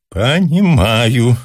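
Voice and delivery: long rapturous drawl; crazed, inspired drawl. A narrator's reading. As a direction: stretch it out as if madly inspired. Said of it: "протянул он сумасшедше и вдохновенно".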